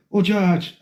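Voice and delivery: lightly imitated Irish woman; Kathy's Irish lilt. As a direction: voice an Irish lady greeting someone. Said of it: "she's like".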